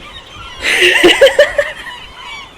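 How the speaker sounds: long high-pitched laugh; high laughter. Real, not acted. Laughing with her partner after the seagull attack, high and long. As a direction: laugh helplessly.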